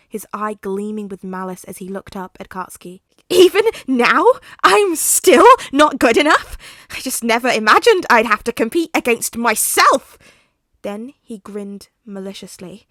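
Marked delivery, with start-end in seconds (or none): 3.3-10.2 loud, high, wounded rage; bitter villain outburst. Acted. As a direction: crack it out with jealous fury.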